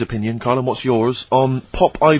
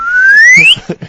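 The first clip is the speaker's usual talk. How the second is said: high squeal laugh; 10 dB louder than usual. Real, not acted. High squealing laugh after joke.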